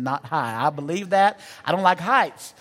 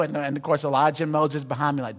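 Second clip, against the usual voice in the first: ordinary talk, nothing marked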